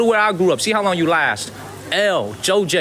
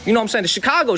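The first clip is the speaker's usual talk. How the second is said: normal talking voice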